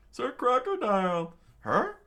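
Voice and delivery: hammed-up cry of recognition; startled onlooker. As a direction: call it out in a high acted voice.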